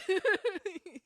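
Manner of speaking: a loud, high laugh bursting out; high burst of laughter. Real, not acted. Laughing at her own joke about the hunter. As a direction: laugh out loud at the silly picture.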